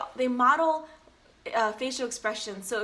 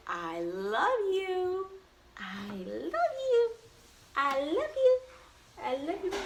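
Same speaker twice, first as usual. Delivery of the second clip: slow, high cooing; 8 semitones higher, slower than usual. Real, not acted. Cooing to baby.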